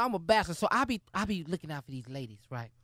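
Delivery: low, soft smooth-talking voice; sultry narrator. Acted. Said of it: "Oh, listen to your voice".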